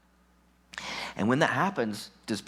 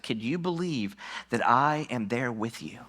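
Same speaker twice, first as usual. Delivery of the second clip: ordinary delivery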